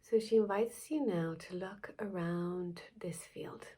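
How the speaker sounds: soft-spoken guided meditation with long pauses. Acted. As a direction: lead listeners gently through the visualisation, phrase by phrase.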